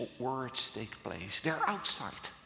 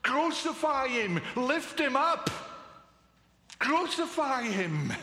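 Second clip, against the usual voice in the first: higher and louder, pressing each crucify; preacher crying the crowd's demand to the congregation, loud and urgent; 7 semitones higher, 4 dB louder than usual. Acted.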